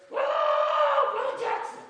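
high, drawn-out holler; hammed-up shriek for someone across a field; long, high "whoa", then the name called out; imitated calling out. Woman calls across big field.

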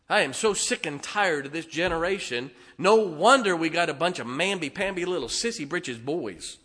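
ordinary delivery

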